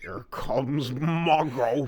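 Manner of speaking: slow, drawn-out movie line; slow movie quote voice. Retold or imitated. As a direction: drag out the quote slowly.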